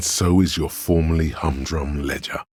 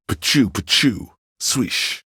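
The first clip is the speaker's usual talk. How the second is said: mock laser sounds; 8 semitones higher than usual. Imitating futuristic sound effects.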